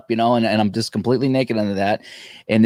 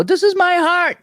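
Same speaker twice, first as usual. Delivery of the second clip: indignant; 4 dB louder than usual. Retold or imitated. Arguing with the doctor.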